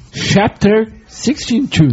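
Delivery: slow reading. Acted. Reading e-mail subject.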